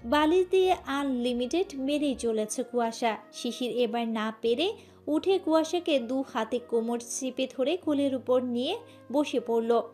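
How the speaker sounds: ordinary delivery, nothing marked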